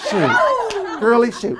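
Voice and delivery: high laughing exclamation. Real, not acted. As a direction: laugh it out in delight. Laughing surprise after trick.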